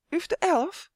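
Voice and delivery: very high-pitched query; astonished question. A narrator's reading. Another fairy hearing Uf's name, high surprised question. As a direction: ask in high-voiced amazement.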